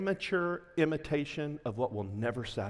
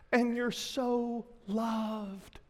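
tender; 5 semitones higher, much slower than usual. Acted. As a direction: linger tenderly over loved. Tender turn after judgment.

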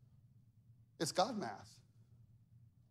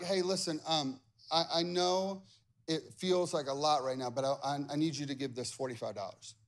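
lower, softer, coaxing; gentle God voice; 6 semitones lower, 4 dB quieter than usual. Retold or imitated. God softly asking Ty to give her tithe, gently. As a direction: ease it in like a patient father.

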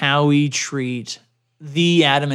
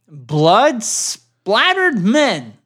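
very slow, higher spooky headline; dramatic horror read; 6 semitones higher, 3 dB louder, much slower than usual. Acted. Host teasing a gory story, slow and spooky. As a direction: drag it out like a horror trailer.

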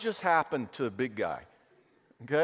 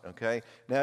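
normal talking voice

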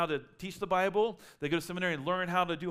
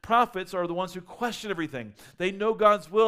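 ordinary talk, nothing marked

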